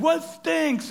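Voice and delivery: very high mock kid voice; high falsetto mock complaint; imitated kids' calls. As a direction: squeal it like a middle schooler. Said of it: "we're hearing".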